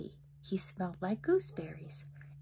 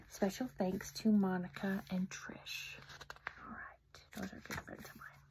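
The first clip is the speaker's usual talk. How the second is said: soft breathy whisper; whispered thanks; 7 dB quieter than usual. Real, not acted. Author quietly thanking her friends in a whisper. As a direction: whisper it like a secret.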